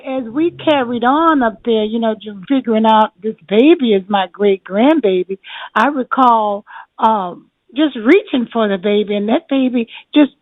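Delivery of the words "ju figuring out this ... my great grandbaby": normal talking voice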